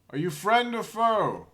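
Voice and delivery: high, loud, stagey call; loud knightly challenge. Acted. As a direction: boom it out like a knight errant. Challenging a stranger in character.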